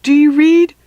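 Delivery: high, loud radio yell. Acted. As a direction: yell into the walkie-talkie. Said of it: "Stink was still yelling".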